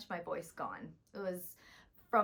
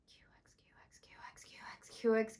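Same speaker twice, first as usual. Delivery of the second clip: whispered exercise. Shown as a demonstration. Voice teacher showing the Q X warm up, whispered. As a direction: breathe the letters out with no voice. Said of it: "So like this".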